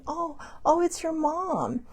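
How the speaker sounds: high, soft, drawn-out dawning wonder; hushed realisation. Retold or imitated. Driver telling sibling it's your mom, hushed realisation. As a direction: break it to them gently as it dawns. Retold realisation that mother is visiting.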